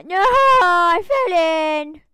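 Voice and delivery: loud, stretched-out dismay; startled cry. Real, not acted. Player falling into her own hole, dragging out a loud wail. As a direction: blurt it slowly as you drop.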